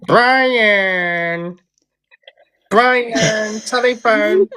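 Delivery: high, sing-song parrot call; parrot calling Brian. Retold or imitated. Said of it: "It used to go".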